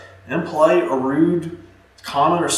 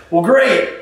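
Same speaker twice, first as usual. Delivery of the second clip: laughing it off; 6 semitones higher than usual. Shown as a demonstration. Agent laughs off objection.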